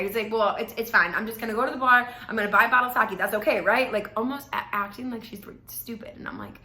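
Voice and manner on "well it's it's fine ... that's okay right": loud, dismissive announcement; brushing her off, louder; entitled attitude. Retold or imitated. Date announcing he'll go to the bar, loud and entitled. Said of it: "Like, that kind of attitude".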